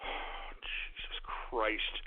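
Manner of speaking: very slow, low, breath-only mutter; exasperated sigh. Real, not acted. Host worn out by his callers, exasperated sighing. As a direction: sigh it out slowly, almost voiceless.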